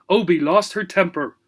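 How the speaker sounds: plain reading voice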